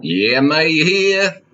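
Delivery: comic vendor answering a customer; fair vendor voice. Acted. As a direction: drag it out like a fairground hawker. Improvised Renaissance fair scene.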